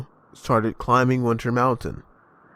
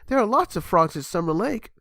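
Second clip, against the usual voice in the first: happy; 7 semitones higher than usual. A narrator's reading. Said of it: "said happily".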